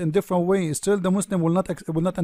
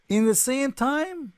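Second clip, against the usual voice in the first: sarcastic amazement; 7 semitones higher, slower than usual. Pretend. Marvelling at seven dialects.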